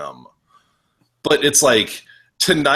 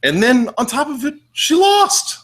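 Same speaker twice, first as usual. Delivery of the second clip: much higher, incredulous lament; exasperated disbelief; 4 dB louder than usual. Real, not acted. Fan lamenting Alexa Bliss's loss, in high-pitched disbelief.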